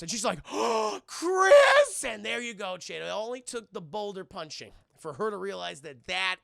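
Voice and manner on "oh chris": gasp, then a high shout; hammed-up reunion cry, very loud; overjoyed recognition. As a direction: cry out the name in melodramatic joy. Acts Claire's sudden recognition.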